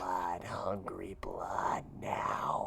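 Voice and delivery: slow hissing whisper of a swarm; buzzing insect swarm chorus. Acted. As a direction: breathe each word like a ravenous swarm. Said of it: "Do your best swarm voice".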